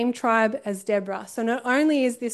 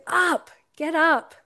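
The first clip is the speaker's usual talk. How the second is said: stern rousing call; 4 semitones higher, slower than usual. Retold or imitated. Deborah rousing a reluctant Barak, slow firm call. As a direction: rouse him off his seat, drawn out and firm. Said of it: "She goes".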